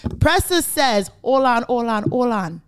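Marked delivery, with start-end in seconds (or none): 1.2-2.6 put-on Jamaican accent. Host retelling the guest's interruption, in a mock Jamaican accent. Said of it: "why I did Jamaican accent".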